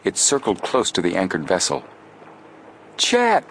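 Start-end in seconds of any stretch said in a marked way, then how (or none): 3.0-3.4 calling out. Acted. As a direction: call through cupped hands. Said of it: "cried Joe, making a trumpet of his hands".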